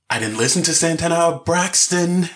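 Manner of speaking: high-pitched, baffled objection; playing a man fed up with a caller; exasperated protest. Acted exasperated phone customer.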